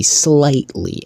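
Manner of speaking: "slightly" drawn out slowly for emphasis; stress on slightly. Real, not acted. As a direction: lean hard on "slightly", stretching it. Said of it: "cannot put any more emphasis on that word".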